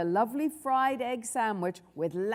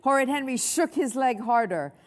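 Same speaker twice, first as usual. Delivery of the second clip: plain reading voice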